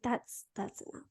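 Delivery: whispered under her breath; a private hushed putting her foot down. Retold or imitated. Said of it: "I'm like".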